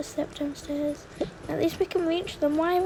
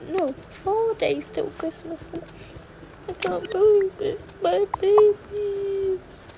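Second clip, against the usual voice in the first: high, drawn-out, crying lament; tearful wail; 4 semitones higher than usual. Acted. Mother, four days before Christmas, cries tearfully over her trapped babies. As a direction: mourn her babies, nearly sobbing.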